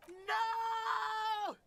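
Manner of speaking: sharp high shout of No; screaming. Acted. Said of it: "The boy screamed".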